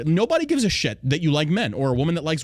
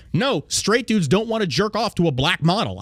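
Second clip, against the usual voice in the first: ordinary delivery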